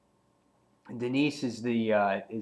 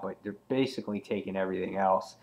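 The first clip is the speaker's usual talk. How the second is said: ordinary talk, nothing marked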